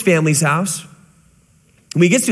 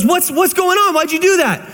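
high startled questioning; a family baffled and alarmed at the outburst; 11 semitones higher than usual. Retold or imitated. The family asks the man why he threw the kettle, alarmed and higher.

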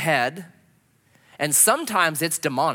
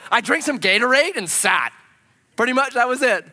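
benchwarmer shrug; 8 semitones higher, 7 dB louder than usual. Retold or imitated. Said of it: "He's like".

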